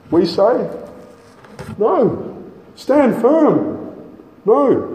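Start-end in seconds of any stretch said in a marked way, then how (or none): 1.8-4.9 high, punched No and Stand firm; emphatic refusal. Acted. Preacher defying false teachers with high emphatic refusal. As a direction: cut off the false teacher with a sharp No.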